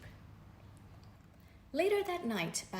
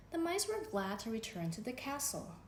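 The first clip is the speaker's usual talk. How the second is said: usual speaking voice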